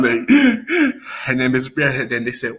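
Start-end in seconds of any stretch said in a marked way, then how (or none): 0.3-1.2 high, hitching gasp after crying; imitated post-cry gasp. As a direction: mimic a kid catching breath after sobbing. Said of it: "when you done crying".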